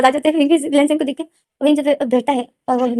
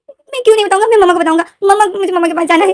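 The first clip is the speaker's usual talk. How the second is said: much higher and louder, wailing defiance; loud tearful protest; 8 semitones higher, 4 dB louder than usual. Acted. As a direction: cry out that you'll tell mama. Lenden wants to tell mum.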